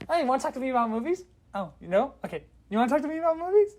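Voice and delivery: high, fast awkward flirting; squeaky eager nerd voice; nervous movie nerd. Retold or imitated. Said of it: "I'm just like".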